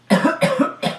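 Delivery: hammed-up sick cough; fake cough. Kid fakes a cough to skip school, hammed-up. Faking sickness.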